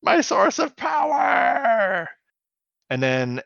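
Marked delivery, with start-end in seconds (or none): 0.0-2.1 high, slow, loud despairing shout; hammed-up villain wail. Retelling the villain's loss, a high slow hammed-up wail. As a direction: wail over the lost power like a cartoon villain.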